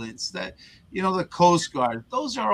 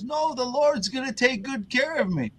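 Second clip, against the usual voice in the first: stubborn priest; 10 semitones higher than usual. Retold or imitated. Priest refuses third time.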